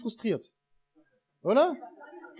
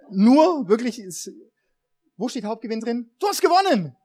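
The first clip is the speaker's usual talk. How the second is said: game show host; 6 semitones higher than usual. Pretend. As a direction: announce the winner like a TV show. Pastor runs mock prize draw.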